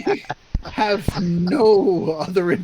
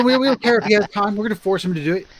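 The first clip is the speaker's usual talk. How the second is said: mock forceful; 3 semitones higher, 4 dB louder, much faster than usual. Joking about forcing a friend.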